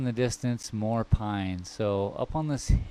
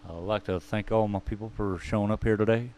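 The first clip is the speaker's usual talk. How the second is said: ordinary delivery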